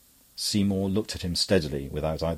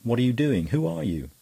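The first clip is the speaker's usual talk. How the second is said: calling out; 6 semitones higher than usual. A narrator's reading. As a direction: call after the priest, demanding. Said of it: "Cutler calling out".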